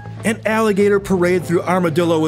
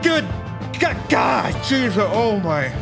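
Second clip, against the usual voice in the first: stunned relief; 5 semitones higher, slower than usual. Real, not acted. Exhausted relief after success.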